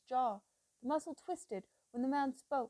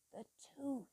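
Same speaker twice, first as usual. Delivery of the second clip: muttered; 8 dB quieter than usual. A narrator's reading. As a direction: mutter it under your breath. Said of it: "Ewa muttered".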